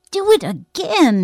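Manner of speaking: slow, loud, high-pitched demand; insistent. A narrator's reading. Boy shakes his friend's arm for an encore, insistent. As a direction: press hard, stretching each word. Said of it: "he insisted".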